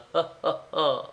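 high acted ha-ha-ha; boozy chuckle in a put-on voice; drunken laugh. A narrator's reading. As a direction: laugh at yourself, tipsily.

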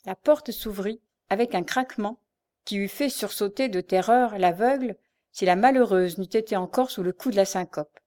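ordinary delivery

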